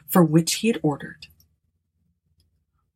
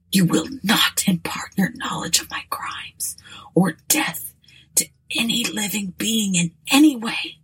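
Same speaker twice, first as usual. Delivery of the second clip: dying words; 2 semitones higher than usual. Acted. Dying man demands an oath.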